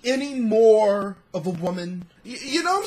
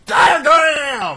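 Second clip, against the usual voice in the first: loud, higher shout of one word; 7 semitones higher, 9 dB louder than usual. Acted. Shouting the punchline at the others.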